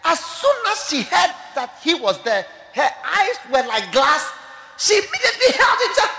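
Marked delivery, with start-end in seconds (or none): none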